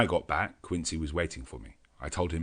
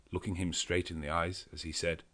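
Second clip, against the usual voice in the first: ordinary delivery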